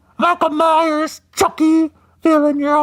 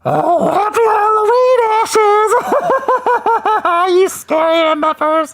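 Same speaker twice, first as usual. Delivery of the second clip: excited shout; 9 semitones higher, 4 dB louder than usual. Real, not acted. Greeting a chat viewer loudly.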